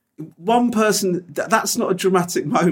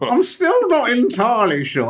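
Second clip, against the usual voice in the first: slow, higher, in the friend's put-on voice; imitating his friend's voice; 5 semitones higher, slower than usual. Shown as a demonstration. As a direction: drawl it like your mate does. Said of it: "I actually became your impression".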